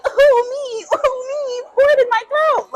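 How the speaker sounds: mock a thirsty girl begging for a drink; mock eager party girl. Mocking the girls who'd beg for the liquor, squeaky and loud. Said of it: "I'm supposed to be like".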